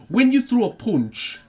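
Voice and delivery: put-on Sunderland accent, high, slow and loud; Tony Jeffries. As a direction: imitate a Sunderland boxing coach giving tips. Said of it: "I have picked up on that accent".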